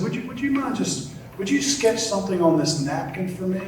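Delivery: high, pleading admirer's voice; a starstruck fan asking a favour, up high; eager fan. Acted. Admirer begs Picasso for sketch.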